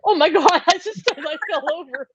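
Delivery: high, laughing disbelief; oh-my-God amazement with a laugh; astonished reaction. Retold or imitated. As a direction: retell being bowled over, voice jumping up high. Retelling her shocked reaction.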